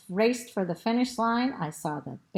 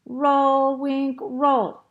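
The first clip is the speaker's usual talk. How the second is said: loud, raised-pitch crowd cheer; 5 semitones higher, 6 dB louder, much slower than usual. A narrator's reading. Students rooting for Wink, cheering loudly. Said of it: "they cheered".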